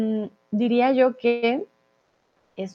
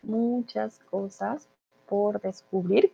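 Muchas cosas por descubrir said softly and slowly; soft slow model phrase; 5 dB quieter, slower than usual. Shown as a demonstration. Teacher repeating the correct phrase for Chris, soft and slow.

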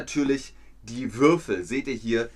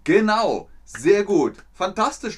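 much higher, much louder praise; excited praise; 8 semitones higher, 8 dB louder than usual. Real, not acted. Teacher cheering learners for the right answer, excited and loud. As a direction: cheer the class on.

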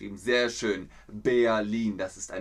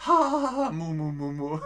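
mock laughter; 5 semitones higher than usual. Mock laugh at a chat joke.

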